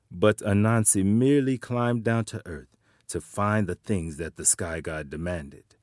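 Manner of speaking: ordinary delivery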